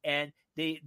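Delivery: normal talking voice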